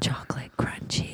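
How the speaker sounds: hushed reverent whisper. Acted. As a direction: breathe the words like a secret. Whispered repeat of the name.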